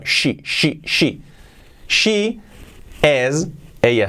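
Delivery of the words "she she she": "she" with a clear hushing SH, not "sí"; SH sound. Shown as a demonstration. Teacher shows the sound to learners, hushed SH in "she". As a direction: repeat "she", starting on a long SH. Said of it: "que es este sonido".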